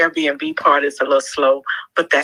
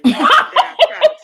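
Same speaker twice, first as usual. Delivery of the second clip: high laughing delight; 6 dB louder than usual. Real, not acted. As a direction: say it through a laugh. Laughing while answering.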